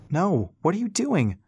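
high-pitched cry of alarm; alarmed shout. A narrator's reading. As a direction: shout it up into the wind, pitch leaping. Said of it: "Rass shouted into the howling wind".

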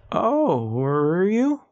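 high, drawn-out uneasy question; concerned, pitch swooping up at the end; creeping concern. A narrator's reading. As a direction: stretch it out, rising high with worry. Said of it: "concern creeping into his voice".

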